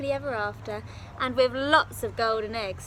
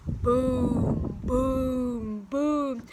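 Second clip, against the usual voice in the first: booming footsteps; 5 dB louder, much slower than usual. Acted. Giant's footsteps sound.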